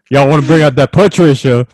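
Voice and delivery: loud put-on character voice, laughing; Patricia voice. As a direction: cracks up mid character. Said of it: "I'll bring out my Patricia".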